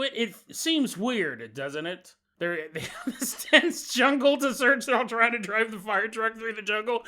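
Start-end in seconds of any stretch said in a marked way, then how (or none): 2.4-7.0 laughing. Acted. Joking narration, acted scene.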